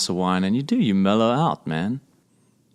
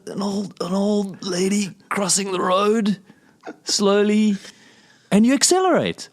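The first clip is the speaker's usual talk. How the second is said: pitched way up, mock disbelief; high incredulous voice; 9 semitones higher than usual. Absurd driving hypothetical, high voice.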